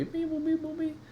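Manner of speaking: high beep boop noises; squeaky robot beeps. Acted.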